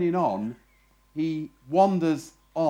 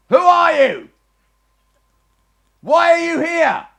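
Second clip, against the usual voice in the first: high, loud challenge from the turret; guard calling down; 12 semitones higher, 12 dB louder than usual. Acted. Sentry shouting down at the wandering rabbi, loud high challenge. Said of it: "And the voice says".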